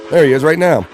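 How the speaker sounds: ordinary talk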